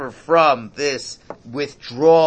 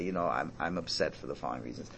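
much softer, measured and reasonable; quietly reasoned, a touch fast; calm and rational; 10 semitones lower, 13 dB quieter, faster than usual. Retold or imitated. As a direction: model the calm spouse explaining themselves. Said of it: "rationally and calmly talk to them".

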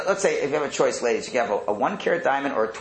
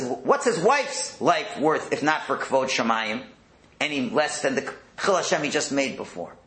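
usual speaking voice

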